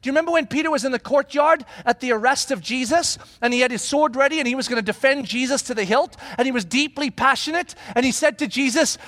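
ordinary talk

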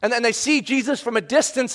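usual speaking voice

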